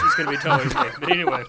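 high squeak of laughter; laughing. Real, not acted. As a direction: laugh at your own joke. Laughs at own joke.

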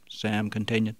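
ordinary delivery, nothing marked

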